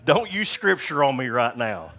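loud, hammed-up objection; loud retold comeback to a wife; mock indignant protest. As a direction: burst out loudly in playful protest.

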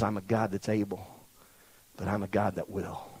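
very soft, low voice; quiet assurance. Acted. God assuring the doubters he is willing, hushed low assurance. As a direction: bring it right down, hushed and sure.

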